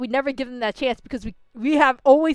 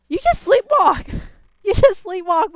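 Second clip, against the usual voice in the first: high, loud disbelief; loud, high-pitched "are you serious" reaction; incredulous outburst; 8 semitones higher, 7 dB louder than usual. Real, not acted. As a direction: exclaim it in sharp disbelief.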